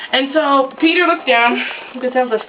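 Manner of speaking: ordinary talk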